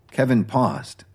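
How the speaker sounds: ordinary delivery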